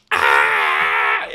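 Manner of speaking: a short loud imitated yelp of pain; mock scream. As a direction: cry out like someone just knocked down. Lady hit by his car.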